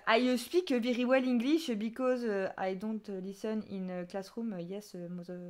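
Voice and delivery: halting English in a thick French accent; terrible English accent. Shown as a demonstration. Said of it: "mon accent anglais est encore pire". (anglais avec un gros accent français)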